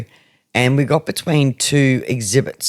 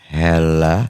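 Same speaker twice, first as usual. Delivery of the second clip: much lower deep male hello; male ghost voice; 9 semitones lower than usual. Retold or imitated. Imitating the male voice on the recording, low hello. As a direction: drop low like a man on tape. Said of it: "you hear this".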